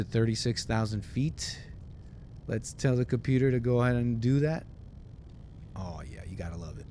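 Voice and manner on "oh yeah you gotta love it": very soft, low and quick; low self-satisfied murmur. Pretend. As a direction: murmur it to yourself, pleased, playing pilot. Pilot pleased with himself.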